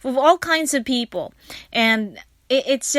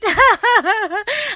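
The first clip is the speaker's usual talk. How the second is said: high laugh; 10 semitones higher, 4 dB louder than usual. Real, not acted. Laugh after stay cool.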